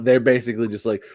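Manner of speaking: ordinary delivery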